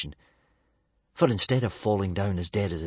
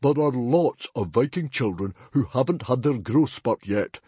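defiant protest; 4 semitones higher, 4 dB louder than usual. A narrator's reading. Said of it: "protested Stoic defiantly".

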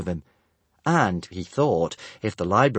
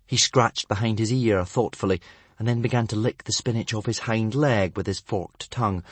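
usual speaking voice

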